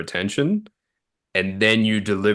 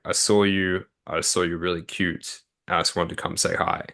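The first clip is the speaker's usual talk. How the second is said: softly and unhurried, with pauses between phrases; a calm, measured pick-up line, taking time; slower, unhurried; 4 dB quieter than usual. Shown as a demonstration. Coach demonstrates approaching a woman so she understands, slower and unhurried. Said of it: "then you say it slower".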